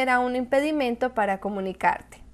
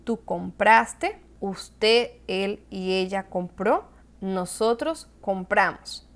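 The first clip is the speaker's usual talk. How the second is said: slow, deliberate conjugation drill; teacher reciting the forms slowly; 3 semitones lower, slower than usual. Shown as a demonstration. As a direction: spell out each form for the class slowly. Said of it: "Verbos en pretérito perfecto simple".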